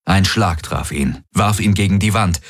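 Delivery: ordinary delivery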